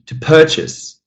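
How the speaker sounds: "purchase" as a verb, still first-syllable stress. Shown as a demonstration. Teacher shows an exception, first-syllable stress in "purchase". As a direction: keep the weight on PUR in "purchase". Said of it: "Nothing changes here".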